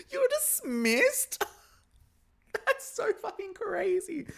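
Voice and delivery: very high, slow squeal through laughter; gleeful disbelief. Acted. Recapper reacting to the dismissal insult, squealing disbelief.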